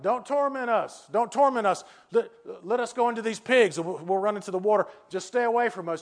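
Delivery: high-pitched mock begging; frightened demons pleading. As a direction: plead urgently, as if scared of his power. Demons beg Jesus to leave them.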